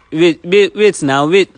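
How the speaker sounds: high, loud pleading call; urgent calling out. Real, not acted. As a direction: call urgently after someone walking off. Host calls after departing guest.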